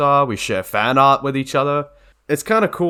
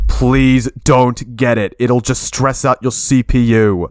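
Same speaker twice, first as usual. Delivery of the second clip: louder, slowed and pleading; emphatic warning; 6 dB louder than usual. Real, not acted. Warning viewers off the buggy plugin, emphatic and louder. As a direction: really lean in and beg them not to.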